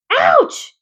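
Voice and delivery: high loud yelp of pain "Ouch"; scream. A narrator's reading. Pig bitten on the tail, high loud yelp. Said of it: "he screamed".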